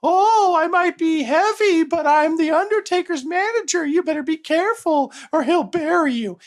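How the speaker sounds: very high, wailing manager voice; over-the-top high-pitched wrestling-manager warning; Paul Bearer in character. Acted. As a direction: squeal it in a loud falsetto character voice. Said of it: "stayed in character".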